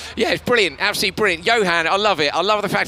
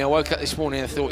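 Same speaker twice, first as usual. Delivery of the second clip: usual speaking voice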